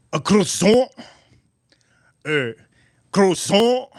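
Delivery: high, rising fake French order; mock French accent. Customer ordering in a restaurant, mock French accent.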